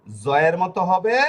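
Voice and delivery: জয়ের begun with a buzzing "z"; z sound. Shown as a demonstration. Teacher showing Italian S's second sound, জয়ের with a slow "z". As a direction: draw out জয়ের slowly, its first sound a "z". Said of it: "ঊনত্রিশ তার জ".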